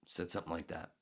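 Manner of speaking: ordinary talk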